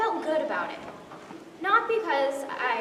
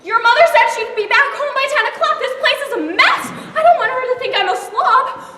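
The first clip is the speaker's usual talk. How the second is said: flustered panic; 7 semitones higher, 8 dB louder than usual. Acted. Panicked babysitter, laughing.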